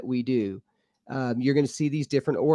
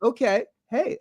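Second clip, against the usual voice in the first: startled reaction; 8 semitones higher than usual. Retold or imitated. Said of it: "I'm like".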